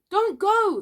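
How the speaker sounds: high, loud plea; pitying call. A narrator's reading. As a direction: call after him in pity. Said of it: "they all called in pity".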